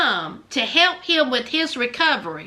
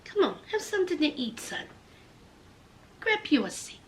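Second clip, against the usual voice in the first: soft mother voice; 3 semitones lower, 10 dB quieter, faster than usual. Retold or imitated. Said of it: "the mama was like".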